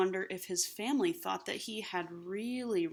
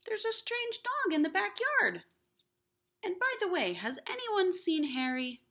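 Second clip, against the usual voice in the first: much higher, puzzled family voice; family member's voice; 9 semitones higher than usual. Acted. Family member at the door, higher and puzzled. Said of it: "One of the family looked out and said".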